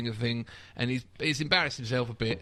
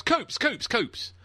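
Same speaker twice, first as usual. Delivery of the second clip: calling out; 9 semitones higher, much slower than usual. Retold or imitated. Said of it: "and he goes".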